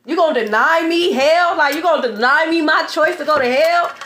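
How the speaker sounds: mock-outraged at being denied hell; incredulous outburst. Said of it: "Imagine me like".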